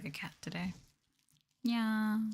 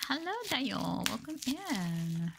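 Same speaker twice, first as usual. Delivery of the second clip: sweet slow greeting; 5 semitones higher, slower than usual. Real, not acted. Greeting a chat viewer.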